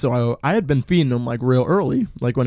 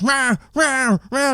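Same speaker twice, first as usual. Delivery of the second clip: very high, insistent mock yowls; high-pitched repeated cat wail; imitated yelling meow; 12 semitones higher, much slower than usual. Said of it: "he was, like, yelling at me".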